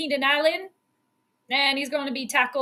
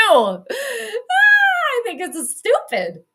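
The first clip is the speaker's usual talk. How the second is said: high, slow, louder laughing groan; 10 semitones higher, 6 dB louder, much slower than usual. Real, not acted. Fan groaning at the Bears' choice, laughing. Fan reacting to the two-point try.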